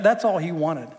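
ordinary talk, nothing marked

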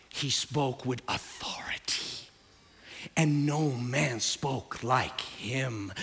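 low, breathy near-whisper; awed hush. Real, not acted. Preacher on how Jesus spoke, awed low hush. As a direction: drop to a grave hush.

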